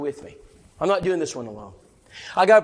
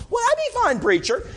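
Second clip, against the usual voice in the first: Sheila's voice; 13 semitones higher than usual. Retold or imitated. Sheila agreeing to the study.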